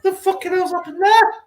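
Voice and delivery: high stunned what-happened cry; retold astonishment. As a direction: squeal it like you can't believe it. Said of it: "I went".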